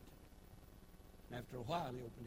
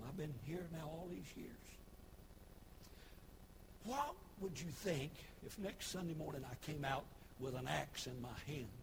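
ordinary delivery

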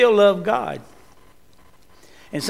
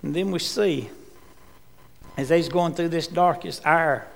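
ordinary talk

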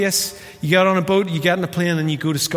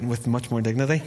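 ordinary talk, nothing marked

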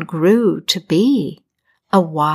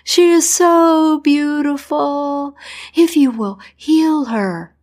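much higher, desperate begging; begging king; 7 semitones higher than usual. Acted. King on his knees begging the doctor for his daughter, high and desperate. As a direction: plead on your knees, voice pitched way up.

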